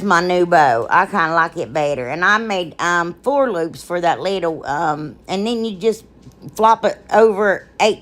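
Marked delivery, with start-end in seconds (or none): none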